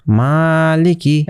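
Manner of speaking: মালিকি drawn out slowly, the long a held one beat; slow it right down and hold the a in মালিকি; one alif stretch. Shown as a demonstration. Teacher showing the class how to read মালিকি slowly. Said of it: "কারণ উপরে মদের হরকত আছে".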